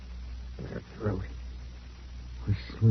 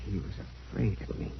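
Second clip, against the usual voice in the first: breathy fast whisper; Paul whispers that Elsie feared him; hushed whisper; 10 dB quieter, faster than usual. Acted. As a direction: let it slip out in a whisper. Creepy confession in the dark.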